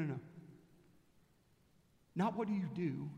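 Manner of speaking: usual speaking voice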